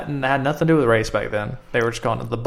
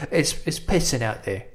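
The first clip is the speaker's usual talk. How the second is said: British slang line; 5 dB quieter than usual. Retold or imitated. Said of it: "they say it's pissing outside".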